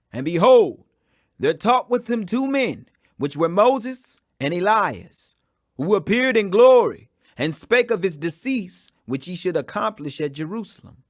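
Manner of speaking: ordinary delivery, nothing marked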